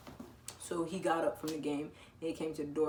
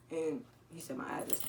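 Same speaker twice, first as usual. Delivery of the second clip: usual speaking voice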